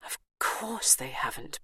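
unvoiced inner whisper. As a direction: whisper the thought. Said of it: "Bernice thought".

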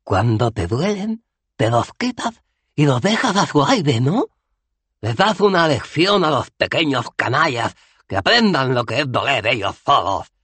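Cohen, más agudo y fuerte; el viejo guerrero razona con fiereza. Acted. (bárbaro Cohen, agudo y fuerte)

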